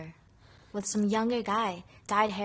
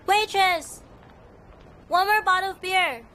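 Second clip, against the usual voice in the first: calling out; 8 semitones higher, 7 dB louder than usual. Acted. Calling the waitress in restaurant.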